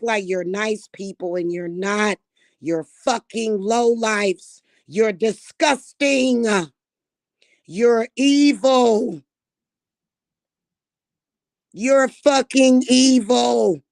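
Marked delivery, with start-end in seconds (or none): 2.6-13.8 slow, loud, bitter insults building up; slow furious insults. Real, not acted. Condemning her family, slow furious insults. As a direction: spit each insult out slowly.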